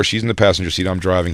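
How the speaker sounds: ordinary talk, nothing marked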